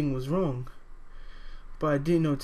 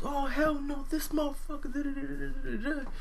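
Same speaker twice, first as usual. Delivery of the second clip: high-pitched imitation of a dad's outrage; dad going wild; 10 semitones higher than usual. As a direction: mimic an angry rant in a high voice, trailing into rapid nonsense. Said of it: "he's going wild".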